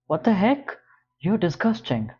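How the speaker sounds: high, loud shocked outburst; shocked disgust. Acted. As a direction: recoil in disgust. Seeing the lewd photos.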